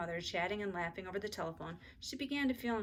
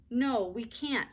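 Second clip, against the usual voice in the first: snapping; 4 semitones higher, 5 dB louder, much slower than usual. A narrator's reading. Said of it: "snapped Beezus".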